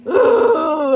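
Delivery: loud, high-pitched "ooh"; exclamatory ooh. Real, not acted. Reviewer gushing over the movie, exclamatory ooh. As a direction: whoop a long ooh, falling. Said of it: "a lot of exclamatory sounds".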